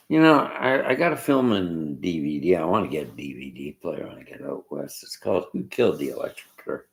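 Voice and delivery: normal talking voice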